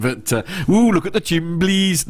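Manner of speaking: a slow, high, hammed-up Brummie accent; jokey Birmingham accent; mock Birmingham accent. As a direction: play up a silly Birmingham accent, knowing it's bad. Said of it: "I'm offending everyone now".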